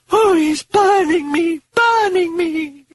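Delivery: very high, slow moan of pain. Acted. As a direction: draw it out as if burning. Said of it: "Reagan was moaning".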